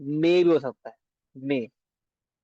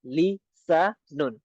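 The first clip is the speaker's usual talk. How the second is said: slow; much slower than usual. Shown as a demonstration. Teacher repeats लिसानुन slowly for students. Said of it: "city की आवाज आएगी".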